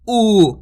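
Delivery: ऊ drawn out long and loud; teacher stretching the long ऊ sound; long stretched oo. Shown as a demonstration. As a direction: hold the ऊ vowel out, long and clear. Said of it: "खींच के उच्चारण हो रहा है".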